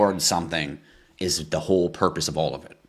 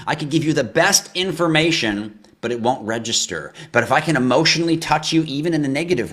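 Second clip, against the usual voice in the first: higher, louder ranting yell; real yelling; 7 semitones higher, 6 dB louder than usual. Real, not acted. Crypto host yelling at his viewers to make it register. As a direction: yell it to reach them emotionally. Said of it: "I get excited and bang my desk and yell".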